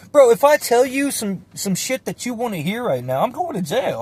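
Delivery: high, emphatic pushback; retold indignant objection. Kid arguing back at the interrogating cop, high indignant retort. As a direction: argue it back, starting loud and high.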